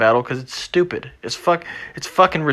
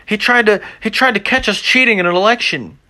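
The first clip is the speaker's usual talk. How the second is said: higher, mocking whiny politician voice; 7 semitones higher than usual. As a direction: sneer it like a corrupt politician whining, pitched up. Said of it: "And you're like".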